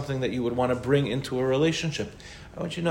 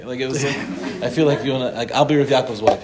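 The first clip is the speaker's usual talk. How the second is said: louder, laughing through the joke; 5 dB louder than usual. Real, not acted. Teasing her co-host about roles, laughing. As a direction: giggle through the words as you tease. Joking about playing Rav Yaakov's wife.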